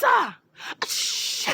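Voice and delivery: normal talking voice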